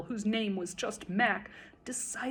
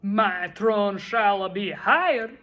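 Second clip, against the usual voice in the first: slow, booming, much louder; thundered; 10 dB louder, slower than usual. A narrator's reading. King proclaiming his throne will rise, thundering. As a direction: thunder out the royal decree. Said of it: "his royal voice thundered".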